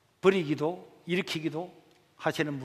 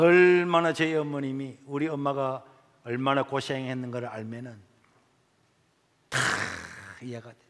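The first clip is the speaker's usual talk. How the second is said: usual speaking voice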